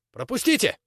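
high shout to clear way. Acted. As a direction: yell for people to move. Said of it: "кричал Цапля".

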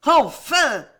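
loud, high triumphant shout; exultant, savage outcry; howl of furious joy. A narrator's reading. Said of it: "avait hurlé Catherine avec un accent de joie furieuse".

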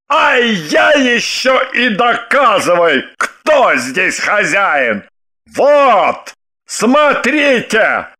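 slow, emphatic outrage; indignant. A narrator's reading. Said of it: "задохнулся от возмущения". (возмущённо, с растяжкой)

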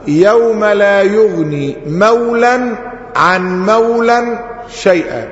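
slow chanted recitation of the verse; Quran recitation. Shown as a demonstration. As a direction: recite it slowly, tajweed style. شيخ يتلو آية في الدرس. (تلاوة مجودة بطيئة)

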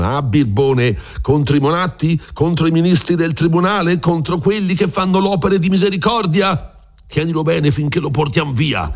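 high, jeering snarl of rage; mock him while holding him down; angry mocking shout. Plague carrier pinning Rodrigo to the bed, jeering and angry. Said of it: "gridando con un versaccio di rabbia insieme di scherno".

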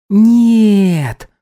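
put-on high toddler shout of no; kid protest. A narrator's reading. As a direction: refuse like a stubborn little kid. Toddler refuses to sleep.

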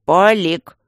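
loud one-word reprimand; sharp bark. A narrator's reading. Guardian silencing his blabbing friend, loud bark. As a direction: bark the name to cut him off. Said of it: "рявкнул Аристарх".

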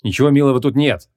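usual speaking voice

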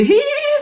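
high eerie hee hee; ghostly giggle. Retold or imitated. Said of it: "vừa nói vừa cười".

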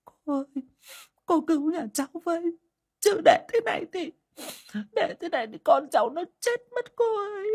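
very high, sobbing desperate begging; sobbing plea. Acted. Bà Hậu clutching the fortune teller's hand, sobbing and begging. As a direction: cry and cling as you plead. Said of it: "khóc nấc lên".

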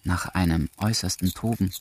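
usual speaking voice

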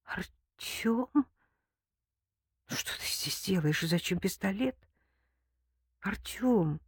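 hushed, hopeless whisper; soft and resigned; doomed whisper. A narrator's reading. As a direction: whisper it as if all is lost. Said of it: "обречённо прошептала".

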